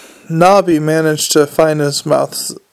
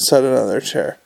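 ordinary delivery, nothing marked

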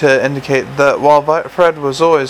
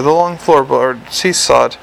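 usual speaking voice

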